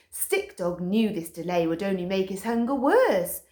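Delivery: ordinary delivery, nothing marked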